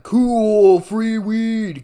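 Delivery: high, loud, gleeful cheer; delighted stoner whoop; stoned excitement. Retold or imitated. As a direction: celebrate the free weed like a kid. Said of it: "at first I was like".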